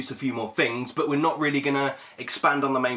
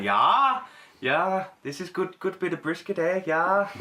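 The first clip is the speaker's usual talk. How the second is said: put-on higher, loose Minnesota accent with a drawn-out yeah; Minnesota accent impression; 6 semitones higher, slower than usual. Mocking the TV show's lead in a Minnesota accent. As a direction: imitate Martin Freeman's Minnesota accent. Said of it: "that weird sort of Canadian American accent".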